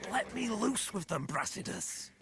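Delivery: eager plea. Acted. Eager mercenary plea for violence.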